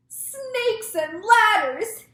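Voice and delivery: very high, loud, drawn-out exclamation; slow, stretched alarm cry; yelled. A narrator's reading. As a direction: yell it in horrified astonishment. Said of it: "yelled the head of the fire department".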